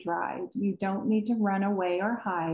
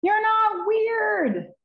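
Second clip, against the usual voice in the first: much higher, louder, very slow and stretched; emphatic reassurance; 12 semitones higher, 5 dB louder, much slower than usual. Acted. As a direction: land it big for the little listeners. Narrator's big punchline to kids.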